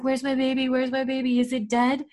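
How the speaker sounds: half-acted worried pleading; mild panicky. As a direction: lightly imitate a frightened mother asking for her baby. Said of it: "She starts getting panicky".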